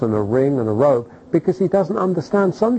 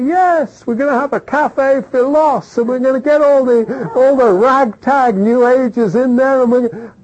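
much higher, louder, bursting with plans; excited dreamer; 8 semitones higher, 4 dB louder than usual. Retold or imitated. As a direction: gush with wild enthusiasm about a cafe. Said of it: "and you say".

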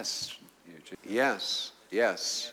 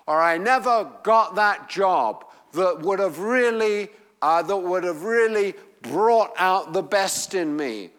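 higher and louder, driving each line home; full-force preaching; 7 semitones higher, 6 dB louder than usual. Acted. Preacher urging a marginal congregation, full force.

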